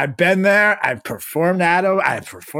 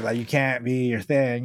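ordinary talk, nothing marked

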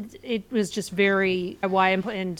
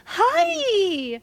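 high, squeaky mock greeting; 12 semitones higher than usual. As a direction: imitate a squeaky-voiced woman. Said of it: "little Miss Squeaky Voice".

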